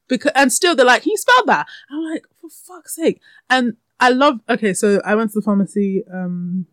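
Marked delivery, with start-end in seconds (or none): 1.0-1.7 very high, loud mock voice; mimicked pharmacy clerk. Pharmacy worker still asking for the spelling, mimicked high. 2.4-3.2 high-pitched retold exasperation; exasperated. Customer fed up with being asked, exasperated.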